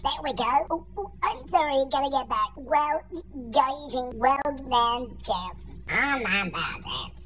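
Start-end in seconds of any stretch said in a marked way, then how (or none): 1.9-7.2 put-on low old man voice. Old man voice keeps going.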